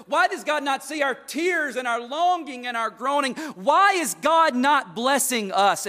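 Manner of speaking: loud, high pleading questions; impassioned preaching. Acted. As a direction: hammer each why at them. Voicing the people's plea.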